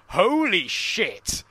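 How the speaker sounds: re-enacted stunned outburst; retold astonishment. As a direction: stretch out each word in amazement. Retells own shock at TV reveal.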